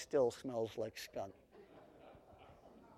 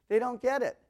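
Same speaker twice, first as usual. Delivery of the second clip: mock exasperation; 9 semitones higher, 7 dB louder than usual.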